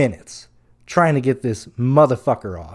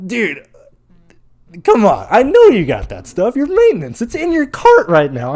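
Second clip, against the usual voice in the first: higher and louder, pleading in disbelief; exasperated plea; 7 semitones higher, 4 dB louder than usual. Retold or imitated. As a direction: beg the maintenance guy, voice rising with frustration. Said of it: "I'm like".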